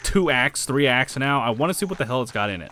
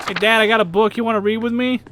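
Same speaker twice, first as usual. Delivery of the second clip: high, loud playful call; calling to Dad; 11 semitones higher, 8 dB louder than usual. Pretend. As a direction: call out eagerly to your dad.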